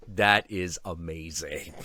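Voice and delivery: slow, hushed wonder; slow awe. Retold or imitated. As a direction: let the awe sink in slowly. Amazed reaction to the story.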